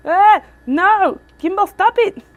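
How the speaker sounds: high, loud frustrated outburst; real exasperated yell. Real, not acted. Vlogger scolding a misbehaving gimbal, exasperated yell. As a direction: shout at the gadget to quit.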